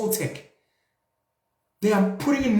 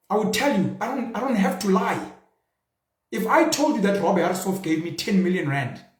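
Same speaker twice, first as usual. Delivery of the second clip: normal talking voice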